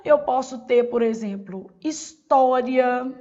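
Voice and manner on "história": "história" slowly stretched, stress on TÓ; stress on penultimate syllable. Shown as a demonstration. Teacher giving a paroxytone example, slow "história" stressed on TÓ. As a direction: draw out "história", leaning on the TÓ. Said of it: "penúltima sílaba acentuada".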